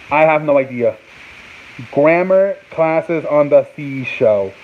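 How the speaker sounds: ordinary talk, nothing marked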